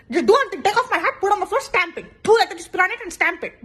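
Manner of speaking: loud, high exasperated rant. Acted. Fed-up friend ranting about the photo, loud and high. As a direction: spit it out in mock outrage.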